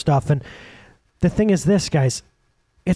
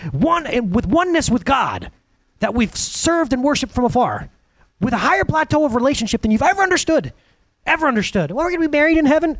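preacher lifting the congregation with rising fervor; fiery preaching; 8 semitones higher than usual. Acted. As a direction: rise into a full-voiced sermon climax. Preaching climax on heaven.

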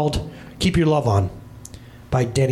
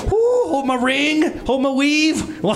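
high, slow, drawn-out sassy voice; hammed-up ready-to-fight sass; sassy mock challenge; 9 semitones higher, much slower than usual. Dad answers his defiant daughter with a high hammed-up sass. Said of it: "And I'm like".

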